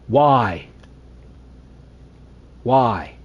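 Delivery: loud, pleading complaint; exasperated. Real, not acted. Gamer lamenting his X factor taken away, exasperated. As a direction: protest loudly with long stunned pauses.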